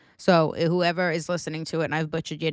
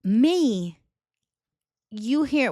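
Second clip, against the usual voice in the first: ordinary delivery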